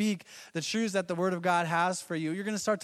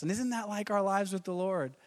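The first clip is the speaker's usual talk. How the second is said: ordinary delivery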